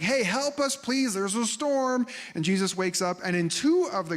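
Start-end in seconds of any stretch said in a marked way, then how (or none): 0.0-2.1 panicked disciples. Retold or imitated.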